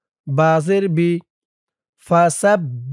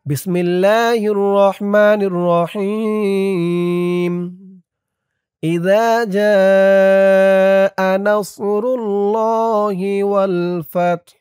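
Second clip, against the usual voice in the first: slow, stretched, chanted Quran recitation, higher; drawn-out recitation; 3 semitones higher, much slower than usual. Shown as a demonstration. Teacher reciting the whole surah slowly for students to follow, drawn-out chant. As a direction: draw out every vowel in a melodic chant. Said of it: "শুরু থেকে একবার reading টেনে টেনে বলে দিব".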